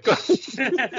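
breaking into a high laugh. Real, not acted. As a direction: say it while laughing. Apologises after awkward joke.

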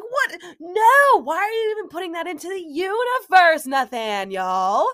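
high, loud yell of disbelief; shocked loud scolding of her partner; retold yell. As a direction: yell it at him like he jinxed everything. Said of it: "I literally yelled at him".

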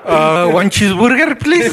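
put-on slow, halting Mexican-accented English, jumping up high on please; mock Tiro ordering in a thick Mexican accent; a friend imitating Tiro's thick Mexican accent ordering food. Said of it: "When he was ordering the wings".

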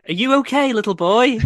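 high, loud mock concern for a lost child. Mocking the lost-looking friend.

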